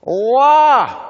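high loud strained "la"; imitating Terry's effortful attempt at love; imitated "la". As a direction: mimic a struggling first syllable. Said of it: "Terry got the L".

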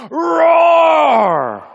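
loud, high, drawn-out roar; big mock lion's roar falling away at the end; imitated lion roar. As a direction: roar like a lion startling the crowd. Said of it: "the lion looks at us and goes".

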